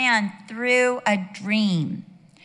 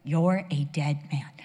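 slow, lower, deliberate pronouncement; God's stern warning; 5 semitones lower, slower than usual. Retold or imitated. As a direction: state it slowly and with finality, as God. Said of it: "I've got another phrase to use".

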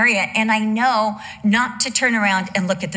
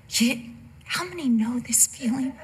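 whisper; 3 semitones higher, 6 dB quieter than usual. Acted. Aside to the congregation.

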